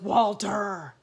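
sharp call of the dog's name; calling Walter. Acted. Father scolding the dog by name, loud call. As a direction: call him out like an annoyed dad.

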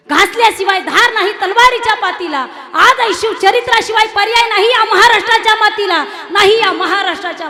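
high, loud proclamation of the saying; preaching cry. Shown as a demonstration. Preacher to young listeners, a high, loud rousing proclamation. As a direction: shout 'घासल्याशिवाय धार नाही तलवारीच्या पातीला' to rouse the crowd. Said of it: "repeated louder".

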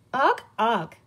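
lightly smug little grunts; smug pollywog. A narrator's reading. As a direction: grunt it, pleased with yourself. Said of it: "said the pollywog smugly".

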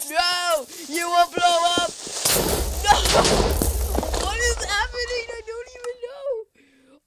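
high-pitched frantic cries; panicked yelling. Real, not acted. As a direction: yell in panic amid total chaos. Explosions, chaos in game.

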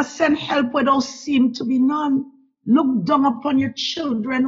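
normal talking voice

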